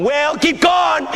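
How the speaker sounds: high-pitched, drawn-out cheering; imitated congregation calls. Congregation responding to old pastor, excited calls. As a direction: urge the preacher on. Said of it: "We were going".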